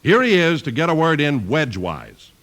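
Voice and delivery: ordinary talk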